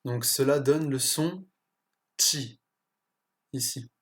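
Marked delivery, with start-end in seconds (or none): 2.2-2.6 "chi" as "tji"; unaspirated tj before a clear i in "chi". Shown as a demonstration. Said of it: "comme un T J associé".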